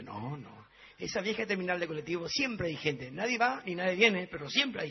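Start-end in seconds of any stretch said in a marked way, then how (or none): none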